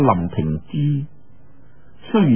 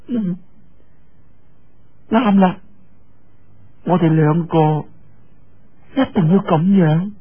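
a bit higher, sweet and sure; lightly voiced young girl; 4 semitones higher than usual. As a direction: say it like a girl in love. Yue Lingshan speaking to Lin. (岳靈珊甜甜咁同林平之講)

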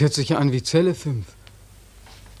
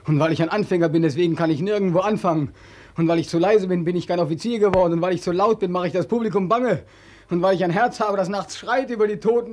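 climbing pitch, line by line, into despair; a desperate outcry that keeps climbing; rising lament; 4 semitones higher than usual. Acted. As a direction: let each because-clause build higher. Beckmann's rising lament monologue.

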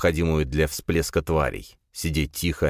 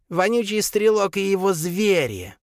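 high spiteful creature voice; 14 semitones higher than usual. A narrator's reading. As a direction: spit out the name with disgust. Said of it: "скривилась в злобной гримасе".

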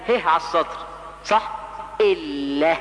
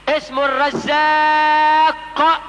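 الرزاق drawn out slowly and loudly, higher; emphatic correction; 6 semitones higher, 4 dB louder, much slower than usual. Shown as a demonstration. Preacher correcting the congregation, loud slow الرزاق. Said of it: "انطقه بمنطوقه الصحيح".

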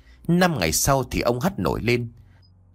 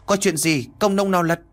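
calling out; 7 semitones higher than usual. Acted. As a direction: shout through cupped hands, pitched high. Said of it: "chắp loa lên miệng hô lớn". (hô lớn qua bàn tay khum)

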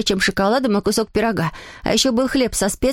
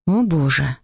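low-pitched exclamation; mother to father; 8 semitones lower, slower than usual. A narrator's reading. Mother exclaims to father after the scolding, in a low voice. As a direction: sigh the words out in a lowered voice. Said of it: "сказала мама папе".

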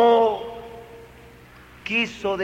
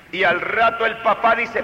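ordinary talk, nothing marked

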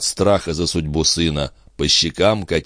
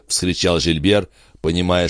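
ordinary delivery, nothing marked

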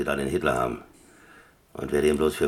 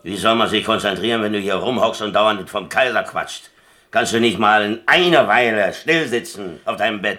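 louder, higher, exasperated scolding; annoyed outburst; 5 semitones higher, 7 dB louder than usual. Acted. Player scolding the Greek for distracting him, louder. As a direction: snap at him to sit still.

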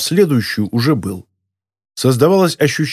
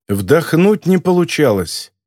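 usual speaking voice

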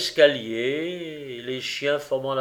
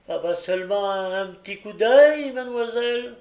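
coaxing plea; 7 semitones higher, 5 dB louder than usual. A narrator's reading. Gilles begging mademoiselle for one look, coaxing. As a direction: voice climbing high, louder at the peak. Gilles coaxes the young lady.

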